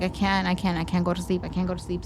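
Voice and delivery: hushed, frozen with fear; scared quiet. Retold or imitated. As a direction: barely voice it, scared stiff. Retold terrified whisper to herself.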